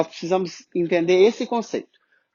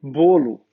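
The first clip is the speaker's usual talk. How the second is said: Bolo as "BOH-loo", lower in pitch; stressed first syllable; 4 semitones lower, 4 dB louder than usual. Shown as a demonstration. Teacher showing the tonic syllable, Bolo stressed on BO. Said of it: "qual que é a sílaba tônica?".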